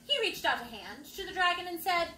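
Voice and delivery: ordinary delivery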